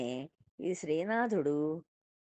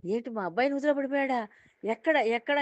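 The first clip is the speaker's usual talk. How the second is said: high, startled alarm, rushing; panicked; 8 semitones higher, 4 dB louder than usual. Retold or imitated. Father hearing his son fell in the well, panicked high cry. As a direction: gasp it out in sudden terror. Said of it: "హడిలిపోయాడు".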